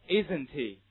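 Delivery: "Isn't he" run together with the h weakened into the link; linking through h. Shown as a demonstration. Teacher drilling h linking for students, linked reading. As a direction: model "Isn't he" as one joined unit. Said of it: "read after me".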